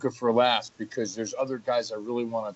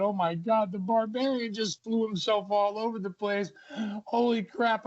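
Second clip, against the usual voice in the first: higher pitched, retold disbelief; stunned; 8 semitones higher than usual. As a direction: replay your own shock at the big fall. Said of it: "and I said".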